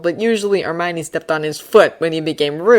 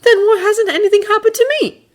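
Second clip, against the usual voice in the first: high, loud sharp retort; countering; 6 dB louder than usual. Acted. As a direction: fire it back at them. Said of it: "Draco countered".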